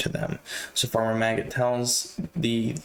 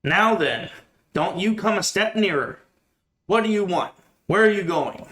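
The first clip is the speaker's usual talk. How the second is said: retold shout; 7 semitones higher than usual. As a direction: call out to a stranger, a bit hammed up. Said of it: "And he shouts".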